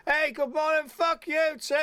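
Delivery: high-pitched imitation of a tipsy catchphrase; steaming uncle. Uncle on his way back into the house, high mock call. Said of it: "he was a bit steaming".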